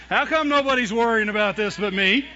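worried outburst. Retold or imitated. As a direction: demand it loudly, half laughing. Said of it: "Somebody should be worried".

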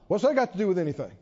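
normal talking voice

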